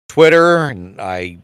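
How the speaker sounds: ordinary talk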